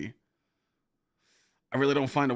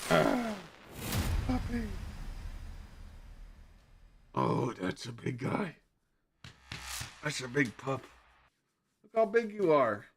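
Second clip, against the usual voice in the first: puppy talk; 7 semitones higher, 4 dB quieter than usual. Real, not acted. Streamer coaxing his puppy to come say hi, high cooing baby talk. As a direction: coo at the dog to coax it over.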